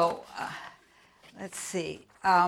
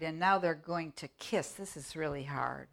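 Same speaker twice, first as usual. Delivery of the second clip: normal talking voice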